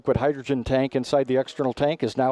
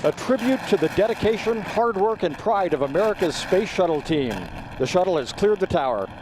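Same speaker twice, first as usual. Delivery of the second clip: excited liftoff call; 5 semitones higher than usual. Real, not acted.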